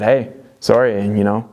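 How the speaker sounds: ordinary delivery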